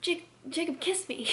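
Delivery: high, halting imitation of desperate pleading; mock pleading. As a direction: imitate a panicked girl begging, stammering. Acts out Bella pleading with Jacob.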